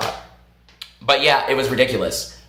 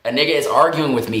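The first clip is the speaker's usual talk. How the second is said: normal talking voice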